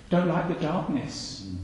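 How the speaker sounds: usual speaking voice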